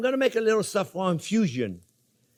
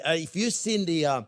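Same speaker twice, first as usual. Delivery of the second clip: usual speaking voice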